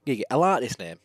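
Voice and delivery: polite and proper, a touch of upper-class English; posh voice. Acted. Amnesiac young man thanking the inspector, lightly posh. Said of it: "a posh voice".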